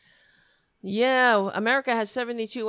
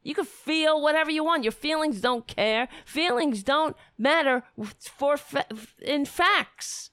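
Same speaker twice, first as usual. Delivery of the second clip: heated, raised rant; angry rant; 9 semitones higher, 3 dB louder than usual. Real, not acted. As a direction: rant it out, pitch up. Ranting at opponents, high and loud.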